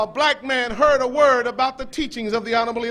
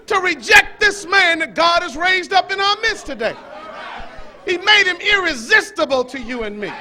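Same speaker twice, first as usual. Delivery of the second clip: louder, higher preaching; rising preacher fervor; 4 semitones higher, 3 dB louder than usual. Acted. Preacher fired up before the congregation, with rising fervor. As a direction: lift the crowd with fire.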